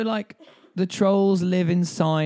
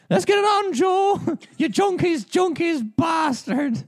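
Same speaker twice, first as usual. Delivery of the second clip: shrill squeaky woman's voice, much higher; high-pitched movie woman; slower than usual. Retold or imitated. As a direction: squeal it up high like a cartoon lady. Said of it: "the woman goes like".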